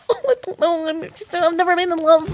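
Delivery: high, faltering imitation of a shy lad; reluctant young writer. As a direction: play him flustered, pitched well up. Voicing the reluctant, inexperienced writer.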